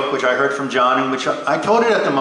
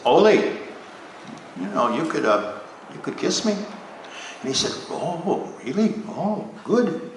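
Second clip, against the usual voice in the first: soft, slow and shy; coy hushed voices; 5 dB quieter, slower than usual. Acted. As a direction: murmur it like a bashful newlywed. Shy newlyweds whisper in car.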